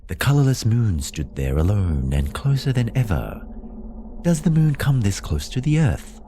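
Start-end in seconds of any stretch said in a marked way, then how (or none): 4.2-6.2 high-pitched, wondering question; narrated wonder in a raised voice. A narrator's reading. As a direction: lift the voice high, as if puzzling aloud. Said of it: "I wondered".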